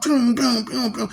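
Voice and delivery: high, loud pew pew zaps; neurons firing. Retold or imitated. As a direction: blast out little laser zaps. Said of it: "no neurons firing off".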